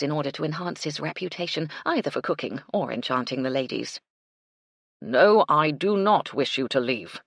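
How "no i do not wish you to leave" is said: slow, louder stiff butler; measured and starched, a proper manservant; stiffly. A narrator's reading. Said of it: "Baxter said stiffly".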